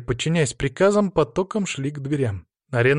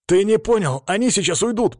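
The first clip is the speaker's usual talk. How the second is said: high, raised, urgent insistence; insistent plea; 8 semitones higher, 4 dB louder than usual. A narrator's reading. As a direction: try desperately to get through to him. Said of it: "повторил я, не теряя надежды достучаться". (настойчиво, громко и высоким голосом)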